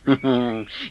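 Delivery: soft, low amused chuckle; an indulgent low chuckle. Acted. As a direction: laugh fondly at the child. Station master amused by child.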